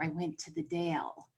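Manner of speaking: hushed secretive whisper. Retold or imitated. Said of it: "somebody will whisper".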